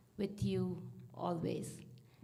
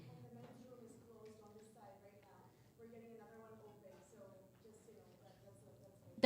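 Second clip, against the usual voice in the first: far away, much softer; off mic; 24 dB quieter, much faster than usual. Real, not acted. Announcer turned away from mic about closed men's room. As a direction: speak from across the room.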